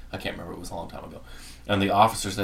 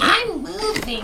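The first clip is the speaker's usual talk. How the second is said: mock whiny voice; slower than usual. Mock quote of cohost.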